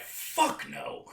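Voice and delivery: high whispered mock refusal; blunt refusal. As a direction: breathe it out high and dismissive. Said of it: "he was like".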